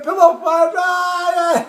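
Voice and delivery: high, loud squeal breaking into a laugh; imitated girlish screaming. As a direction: mimic screaming girls, hammed-up. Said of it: "Girls screaming at".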